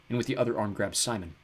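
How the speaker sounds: usual speaking voice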